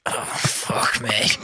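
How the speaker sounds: slow, voiceless groaned-out words; imitated hangover misery; hungover groan. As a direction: groan it like a sick drinker in bed. Imitates hungover dad in bed.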